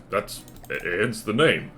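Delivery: ordinary delivery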